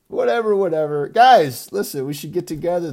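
high, very loud shout, then fast; loud outburst. Real, not acted. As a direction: burst out yelling to the group. Loud burst calling guys together.

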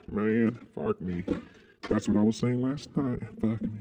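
usual speaking voice

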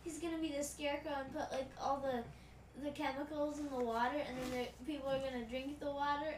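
normal talking voice

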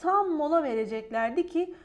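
plain reading voice

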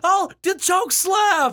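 high, drawn-out mock shout; hammed-up wrestler's yell. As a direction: cry out high and stretch each word. Joins the mock Cena yelling.

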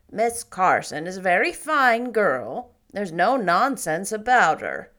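severe. Acted. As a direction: scold the boy firmly as Marilla. Marilla continues.

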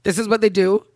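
high, tearful protest; really upset, pleading through tears; crying. Real, not acted. Woman protests about men to her friend, crying. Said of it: "got the cry train moving".

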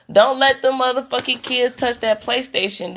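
normal talking voice

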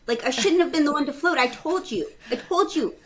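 high, fretful retold complaint; high-pitched I-told-you-so; panicked protest. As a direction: rattle it off in mock panic. Acted panic before manager.

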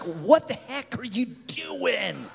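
slow, drawn-out incredulous reproach; mock scolding look. Pastor eyeing the couple across the room, mock scolding. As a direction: stretch out a disbelieving telling-off. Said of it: "gives me the eye".

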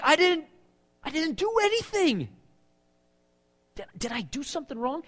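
high, bewildered protest; an innocent man pleading he did nothing; confused David. Retold or imitated. David, hunted by the king, protesting in high bewilderment. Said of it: "he's like".

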